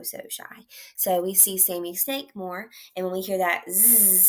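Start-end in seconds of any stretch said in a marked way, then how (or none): 0.0-0.7 hushed, shy aside; shy whisper. Shown as a demonstration. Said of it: "shy zebra whispered".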